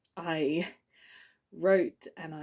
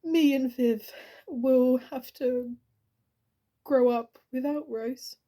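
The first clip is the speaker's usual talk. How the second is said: higher-pitched, halting, understated grief; grieving; 5 semitones higher than usual. Acted. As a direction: hold back grief at a friend's funeral. Eulogy for dead friend, grief rising.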